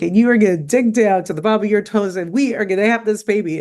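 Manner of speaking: imitating a friend's firm rallying order; retold tough-love pep talk. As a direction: call her up to the task, lightly acted. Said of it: "the calling up. She wasn't kind".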